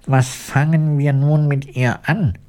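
worried question. A narrator's reading. Woodman asking about sleeping Elli, worried. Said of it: "fragte der Holzfäller besorgt".

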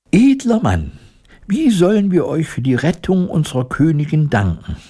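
high, formal little mouse voice; mouse lady-in-waiting. A narrator's reading. First lady-in-waiting thanking the woodcutter, high courtly mouse. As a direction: address the hero in a high courtly voice.

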